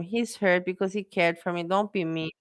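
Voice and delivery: ordinary talk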